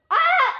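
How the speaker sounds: sudden high shriek; startled scream. Real, not acted. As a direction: shriek in the middle of a jump. Jumping in game.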